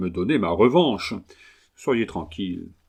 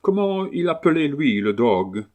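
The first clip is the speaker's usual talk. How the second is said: higher-pitched Englishman speaking broken French; English accent; 5 semitones higher than usual. Acted. Englishman haggling over his new dog, English accent. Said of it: "l'accent anglais".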